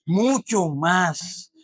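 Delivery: "Mucho más" stretched very slowly, MUCHO weighted; slow each syllable of "mucho más" for weight; preacher's drawn-out stress; drawn-out emphasis. Shown as a demonstration. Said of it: "¿cómo?".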